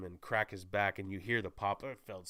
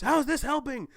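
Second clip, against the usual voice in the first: much higher, much louder yell of frustration; exasperated shout; 9 dB louder than usual. Retold or imitated. Hero scolding squabbling teammates, loud high exasperated shout. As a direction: snap at the others mid-fight. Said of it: "Tobey Maguire shouts".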